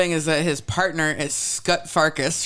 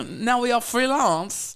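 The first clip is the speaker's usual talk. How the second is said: put-on Russian accent; 6 semitones higher, slower than usual. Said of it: "the Russian accent is not there".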